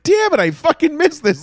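high, exasperated complaint; frustrated at missing it; frustrated outburst. Retold or imitated. Missed the comeback game.

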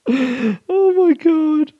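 very high, wheezy squeal after laughing; weak with laughter. Real, not acted. Podcaster recovering from a giggling fit, helpless high squeal.

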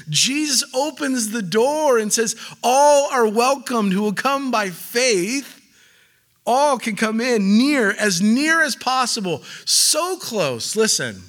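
high, loud, fervent preaching. Real, not acted. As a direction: preach it with full force. Preaching at full force.

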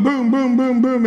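normal talking voice

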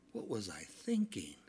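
a hushed, breathy whisper of regret; a sheepish whispered aside; whispered thought. Retold or imitated. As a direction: whisper it to yourself. Retold thought after being sent back.

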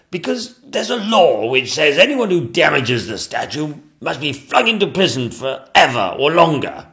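high, loud royal declaiming; pompous King. Acted. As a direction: lay down the law as a storybook king, easing off at the end.

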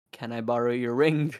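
low, offhand request; very casual. Retold or imitated. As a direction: ask it casually, pitch dropped. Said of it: "very casually looks at Johnny and goes".

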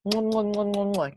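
string of quick smacky kisses; noisy little air kisses; kissing sounds. Pretend. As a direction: kiss the phone over and over, mwah. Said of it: "I'm kissing my phone".

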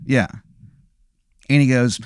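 usual speaking voice

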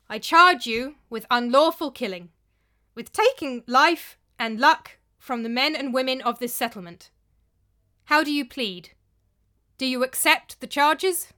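loud formal accusation before the hall. A narrator's reading. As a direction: pronounce the charges forcefully. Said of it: "he said in a loud voice".

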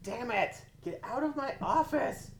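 high, exasperated yell at the cat. Real, not acted. Cat interrupts the recording.

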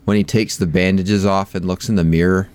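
normal talking voice

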